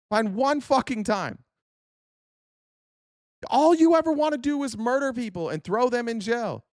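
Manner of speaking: high, heated outburst; angry. Real, not acted. Ranting at a chatter, high angry voice. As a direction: hammer the point home in anger. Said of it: "You're goddamn right I'm mad".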